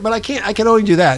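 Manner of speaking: ordinary talk, nothing marked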